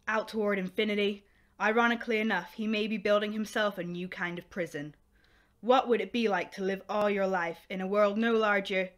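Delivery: rich, measured announcer read over music; grand sci-fi radio intro voice. Acted. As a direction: announce the show like old-time radio. Radio announcer opens the show.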